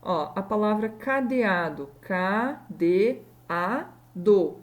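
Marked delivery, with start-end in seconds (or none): 2.0-4.6 slow "K D A D O", each syllable on its own. Shown as a demonstration. Teacher showing the hiato in cadeado, syllable by syllable. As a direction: spell it out in slow, separate syllables. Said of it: "eu consigo separar".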